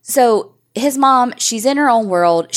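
ordinary delivery

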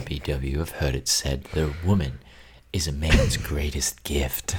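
low, whispered sultry croon. Pretend. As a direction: breathe it like a smooth lover. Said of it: "put on his sultry voice".

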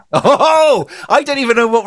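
loud, high burst of laughter; a laughing high yelp; real laugh. Real, not acted. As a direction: crack up loudly.

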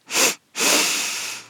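two sharp sniffs in through the nose; audible double nose inhale. Shown as a demonstration. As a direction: sniff in twice, short then long. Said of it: "Double inhale".

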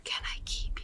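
soft breathy whisper. Retold or imitated. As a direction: whisper it into her ear. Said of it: "whispers in her ear".

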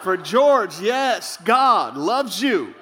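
slow, laughing prophetic word; mock prophecy. Boy prophesying to Curious George, slow and laughing. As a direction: deliver a tender word to a toy. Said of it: "I'd get a word from God".

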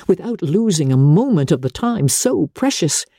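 usual speaking voice

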